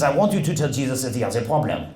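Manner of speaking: put-on French-accented priest. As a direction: imitate a French priest's English. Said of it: "there's a priest visiting from France".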